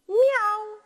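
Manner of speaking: cat meow. Acted. Teacher reading to kids, voicing the cat's meow. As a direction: mew it like a cat.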